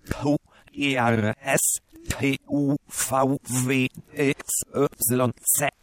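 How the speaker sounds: tape played backwards; reversed audio of backwards speech; reversed playback. Shown as a demonstration. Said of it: "vorwärts laufen lassen".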